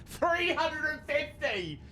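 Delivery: amazed exclamation. Real, not acted. Streamer stunned by three hundred fifty gifted subs, amazed high cry. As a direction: shout the number in sheer amazement.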